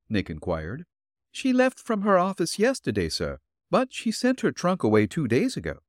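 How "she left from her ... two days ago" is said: put-on higher, gentle old woman's voice; elderly landlady voice. Landlady answering the detective at her door, politely.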